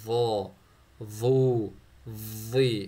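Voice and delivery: وا وو وی said loud and thick, tongue out, air held; loud, thick emphatic syllables; heavy emphatic letter. Shown as a demonstration. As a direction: boom each of وا، وو، وی out with puffed cheeks. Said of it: "اس طرح سے آپ نے پڑھنا ہے".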